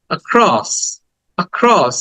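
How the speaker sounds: "Across" with stress on "cross" after a short "আ"; brief "আ", then lean on "cross" in "across"; stress on second syllable. Shown as a demonstration. Teacher showing the stress mark in across, stressed "cross". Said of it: "এটাকে একটু stress দিব".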